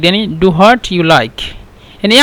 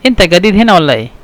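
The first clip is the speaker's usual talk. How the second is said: quick colloquial question; much faster than usual. Shown as a demonstration. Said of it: "نفس الكلام".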